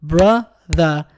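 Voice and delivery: brother split slowly into two beats, bro-ther; slow clapped syllables. Shown as a demonstration. Teacher claps brother for pupils, slow and split. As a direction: clap out brother slowly, one beat per syllable. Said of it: "ننطقها ونصفق أثناء نطقنا".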